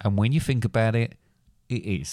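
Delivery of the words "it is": low, soft sign-off; understated cue to cut; signalling to stop recording. Real, not acted. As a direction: drop low and quiet to wrap it up. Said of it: "you speak in a specific way".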